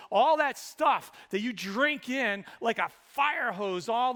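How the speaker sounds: loud, high rising sermon climax; fervent pulpit build; preaching at full force. Acted. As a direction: drive each "all" home.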